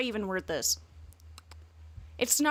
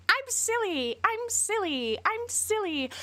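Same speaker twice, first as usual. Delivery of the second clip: put-on very high, childish singsong; mock a quirky kid saying silly; mocking cutesy voice; 11 semitones higher, slower than usual. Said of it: "how he's like".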